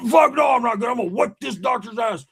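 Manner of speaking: high, strained mock fury; hammed-up outraged holler; angry yelling. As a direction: act out an enraged patient shouting threats. Acts out enraged friend after surgery.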